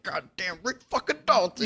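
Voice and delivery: high-pitched mock weeping; imitated crying. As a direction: wail it like an actor breaking down, hammed up. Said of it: "he just starts crying".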